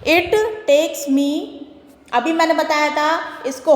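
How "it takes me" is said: very slow sentence reading; slow teacher read-aloud; slow dictation. Shown as a demonstration. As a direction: drag it out so the class can follow. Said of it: "next है".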